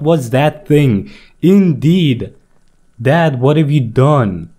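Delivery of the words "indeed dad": normal talking voice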